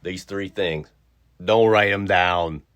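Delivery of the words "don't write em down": slow, loud, firm teacher warning. Real, not acted. Teacher telling kids not to write, firm and loud. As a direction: lean on it so nobody writes. Teacher warns class firmly.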